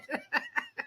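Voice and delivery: a squealing high laugh. Real, not acted. Laughing at her dad's unfinished swearing, a high laugh.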